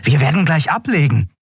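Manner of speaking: high, joyful announcement; beaming with joy. A narrator's reading. Boy announcing imminent departure to Xea, overjoyed. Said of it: "verkündete Miko freudestrahlend".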